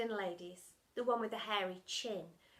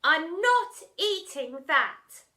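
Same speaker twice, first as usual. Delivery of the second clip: 1 firm refusal; 7 semitones higher, 13 dB louder, much slower than usual. Acted. Girl refuses firmly.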